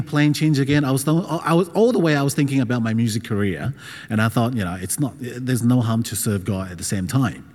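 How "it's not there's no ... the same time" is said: ordinary talk, nothing marked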